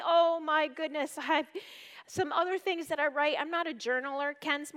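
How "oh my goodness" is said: ordinary talk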